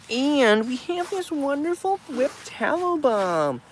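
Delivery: high, slow put-on pitchman voice; funny sales voice. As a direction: croon it like a cartoon seller. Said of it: "I just like making the voice".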